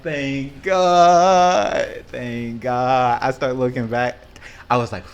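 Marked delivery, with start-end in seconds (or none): 0.0-3.2 loud, relieved Thank God; relieved exclaim. Retold or imitated. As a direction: cry out in relief as help arrives. Relieved rescue by brother.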